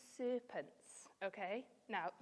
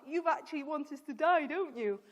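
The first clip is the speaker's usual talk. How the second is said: louder mock accusing tone; acted accusation; 4 semitones higher, 7 dB louder than usual. Teacher voicing the people telling God off, mock accusing. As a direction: throw it out as a raised, cross challenge. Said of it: "they're telling God".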